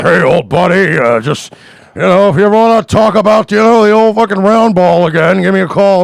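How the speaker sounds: a loud, mock-chummy drunk buddy on the phone; drunk Keith Olbermann. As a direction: ham up a tipsy old pal asking for work. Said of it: "made no less than three drunken phone calls".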